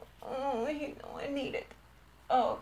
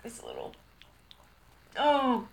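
usual speaking voice

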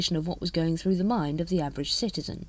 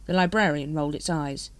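ordinary delivery

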